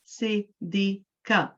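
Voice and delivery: name split slowly into three separate syllables. Shown as a demonstration. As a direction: say Siddiqa slowly, one syllable at a time. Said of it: "how many syllables do I have?".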